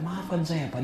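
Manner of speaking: very soft mock-tearful plea; hushed, understated crying apology; tearful apology, imitated. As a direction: quietly act out a remorseful wife begging forgiveness. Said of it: "dia nangis".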